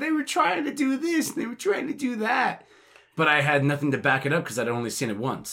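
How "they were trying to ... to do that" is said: defensive voice. Retold or imitated. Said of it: "I was like".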